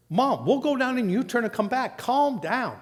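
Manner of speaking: lightly voiced son calming mom. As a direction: talk her down gently.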